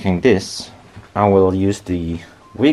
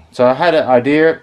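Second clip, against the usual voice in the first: cigarette in mouth; 6 semitones higher, 5 dB louder than usual. Real, not acted. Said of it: "I have a cigarette in my mouth".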